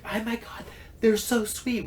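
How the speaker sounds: high, soft, cooing gush; gushing waitress. Retold or imitated. Waitress hearing he paid for the kids, high and cooing. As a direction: melt over how sweet they are.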